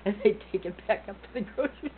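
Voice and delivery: high giggly telling; laughing while telling. Real, not acted. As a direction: giggle through it. Laughing telling Terry story.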